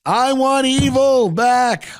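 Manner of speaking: slow mock rally shout; hammed-up villainous campaign shout. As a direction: bellow it like a crowd-pleasing candidate. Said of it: "People are just screaming".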